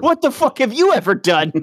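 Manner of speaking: potato mocking the dill; mock indignant. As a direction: challenge him like a jealous vegetable. Vegetables trading insults.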